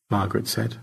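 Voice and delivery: ordinary delivery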